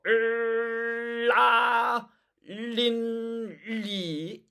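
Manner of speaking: 을 held as a long L bursting into 라; forceful held L before 라, 린, 리; high, loud, drawn-out L on 라, 린, 리; tongue pressed hard. Shown as a demonstration. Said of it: "혀에 힘을 꽉 줘서".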